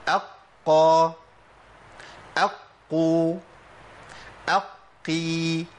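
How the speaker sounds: "أقى" "أقو" "أقي" very slow, qaf held doubled; doubled qaf drills. Shown as a demonstration. Teacher drilling learners on shadda qaf, slow and deliberate. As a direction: stretch each doubled qaf slowly. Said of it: "في حالة تشديد هندخل عليه همزة".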